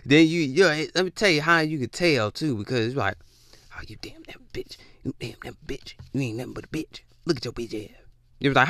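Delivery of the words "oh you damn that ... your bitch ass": hushed, rapid mock demon muttering; muttered insults. Demon slipping out of someone, imitated hushed insults. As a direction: mutter curses under your breath, almost whispered.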